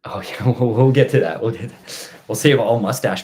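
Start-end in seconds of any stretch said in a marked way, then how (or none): none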